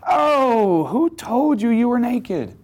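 a pained, drawn-out plea, pitched high; grieved father God. Acted. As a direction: ask it like a father whose child's innocence is gone. God's grieved question acted out.

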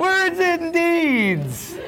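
very high, stretched-out singsong delight; exaggerated enthusiasm. Teacher echoing the class's answer with exaggerated enthusiasm. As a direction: draw each word out in mock triumph.

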